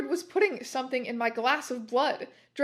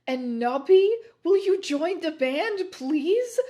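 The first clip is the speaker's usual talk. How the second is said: higher, louder, slow pleading; begging; 6 semitones higher, 7 dB louder, slower than usual. A narrator's reading. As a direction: beg them to join, drawn out. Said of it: "begged Lucian".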